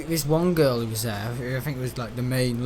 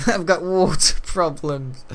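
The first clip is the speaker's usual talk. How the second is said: slow words broken by real laughter; laughing; 4 semitones higher, 4 dB louder, slower than usual. Real, not acted. Joking to a friend about his water problems, laughing. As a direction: laugh through it, drawn out.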